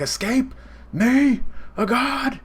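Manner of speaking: way up high, incredulous and mocking; crazed disbelief. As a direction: scoff at the very idea as a god. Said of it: "He's going crazy".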